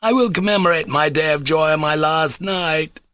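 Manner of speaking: near-choking exaltation. Acted. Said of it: "He was almost choking".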